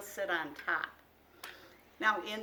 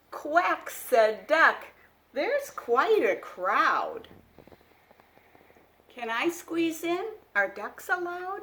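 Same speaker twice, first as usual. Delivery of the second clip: put-on louder, lively read-aloud for small children; picture book reading; 5 semitones higher, 9 dB louder, slower than usual. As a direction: read it like a bedtime storybook. Reads animal dialogue.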